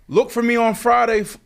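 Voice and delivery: high, loud mock announcer voice; booming big-announcement voice; Shannon Sharpe impression.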